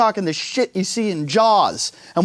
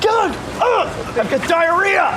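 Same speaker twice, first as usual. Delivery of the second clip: mock panic; 10 semitones higher than usual. Imagined panic in wetsuit.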